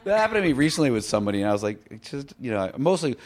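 ordinary talk

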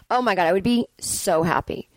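usual speaking voice

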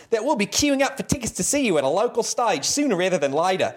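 a lightly put-on, shaky Australian accent read aloud; bad Australian accent. Interviewer reading an Australian fan's letter, bad Australian accent. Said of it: "I do a bad Australian accent".

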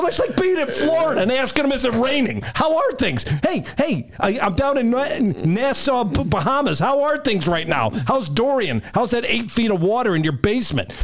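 high, mocking tirade; sarcastic high-pitched rant. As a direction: ridicule the question with absurd comparisons. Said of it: "I go".

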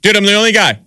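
high, lightly mocking reply; mock customer reply. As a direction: answer the usher in a high voice. Customer answering usher.

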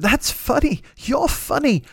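high-pitched English praise impression; John Cleese. Hero praises the starstruck comedian, high-pitched impression. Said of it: "he looked at me and he said".

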